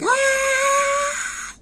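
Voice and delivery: long, very high-pitched shriek; mock scream. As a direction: squeal in imitation of someone being beaten. Imitates woman hit with baton.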